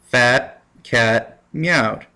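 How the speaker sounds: slow, drawn-out phrase to remember; slow mnemonic. Shown as a demonstration. Teacher drawing out a memory phrase, slowly. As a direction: spell it out slowly as a memory trick. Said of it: "So, the".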